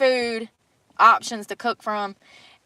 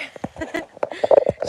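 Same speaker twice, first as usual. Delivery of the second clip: laughing; 8 semitones higher than usual. Real, not acted. Laughing at the river name.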